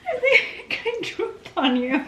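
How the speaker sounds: laughing. Real, not acted. Giggling in bed together.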